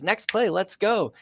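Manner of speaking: retold pep call, slowed and lifted; coach's rallying call. Coach to a player after a good play, imitated rallying call.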